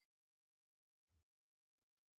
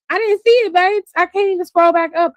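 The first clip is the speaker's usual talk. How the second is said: loud protest; 5 semitones higher, 7 dB louder than usual. Real, not acted. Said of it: "answering chat, louder".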